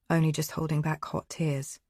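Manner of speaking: usual speaking voice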